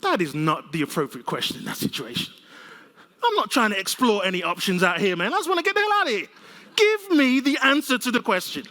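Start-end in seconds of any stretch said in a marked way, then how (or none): 3.2-8.6 louder and higher, half-laughing exasperation; exasperated outburst. Acted. Speaker retelling the fire alarm, exasperated and laughing. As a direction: demand the answer, near laughing in disbelief. Exasperated rant about Jose's question.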